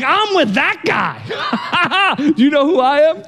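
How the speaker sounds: high, slow bragging with laughter; boastful disciple. Retold or imitated. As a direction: show off laughing to the crowd. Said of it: "they're like".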